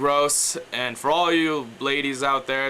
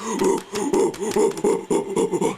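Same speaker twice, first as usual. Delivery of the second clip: gorilla growl; 6 semitones higher, 3 dB louder than usual. Shown as a demonstration. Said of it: "sounds like a gorilla".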